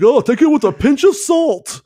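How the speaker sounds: high, loud prissy mock reporter. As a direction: sneer at the pompous rumor reporter. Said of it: "who talks like that?".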